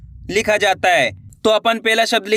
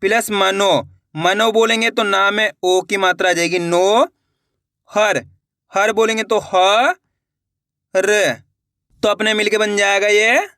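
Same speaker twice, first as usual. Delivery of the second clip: ordinary delivery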